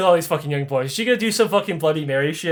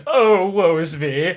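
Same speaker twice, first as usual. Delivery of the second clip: theatrical woe; slower than usual. Retold or imitated. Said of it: "He's like".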